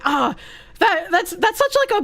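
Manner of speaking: normal talking voice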